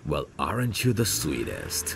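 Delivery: ordinary talk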